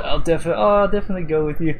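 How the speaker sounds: imitating a sudden bright sweetness; sweet cheerful voice. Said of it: "she's like".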